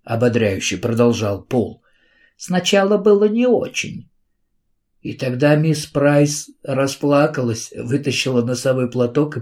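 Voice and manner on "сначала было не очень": high put-on voice. A narrator's reading. Encouraging Paul voices teacher.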